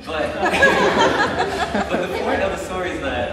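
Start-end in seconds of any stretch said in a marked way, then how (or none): 0.4-1.8 a high, loud burst of laughter; laughing about being caught by his mom; big laugh. Real, not acted. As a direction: crack up helplessly.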